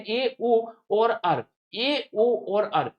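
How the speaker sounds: each letter drawn out, slow and deliberate; slow dictation. Shown as a demonstration. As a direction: spell out ए, ओ, अर slowly for students to note. Teacher dictating the trick letters. (धीमे-धीमे लिखवाते हुए)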